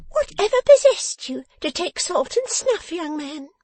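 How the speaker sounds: high, piping, loud scolding voice; sharp little dressmaker. Acted. Dressmaker questioning the beaten man, sharp and high. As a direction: pipe up sharply at a foolish young man.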